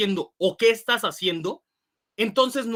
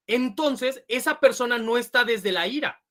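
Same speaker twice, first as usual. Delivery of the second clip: ordinary delivery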